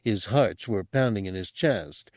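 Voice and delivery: ordinary delivery, nothing marked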